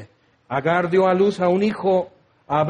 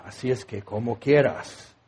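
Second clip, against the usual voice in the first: dismissive; 8 semitones lower, 5 dB quieter than usual. Retold or imitated. Old preachers' dismissive warning, acted.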